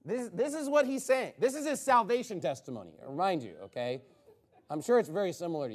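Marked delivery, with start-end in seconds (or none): none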